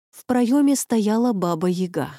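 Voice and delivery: plain reading voice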